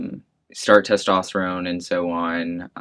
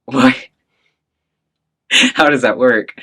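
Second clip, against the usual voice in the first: baffled; 4 semitones higher, 10 dB louder than usual. Retold or imitated. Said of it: "And I'm like".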